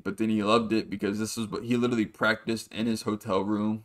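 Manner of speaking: ordinary delivery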